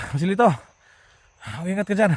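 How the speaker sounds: high urgent shout across the field; calling out. Real, not acted. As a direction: yell the name to find him. Calling to wounded teammate.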